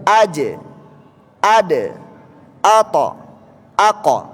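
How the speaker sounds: "Aje, ade" memantul lepas tanpa hamzah; qalqalah benar. Shown as a demonstration. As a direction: ucapkan "ato, ako" dengan pantulan turun, tidak tercekat. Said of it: "Tidak ada bunyi e-nya". (contoh benar satu per satu dengan jeda)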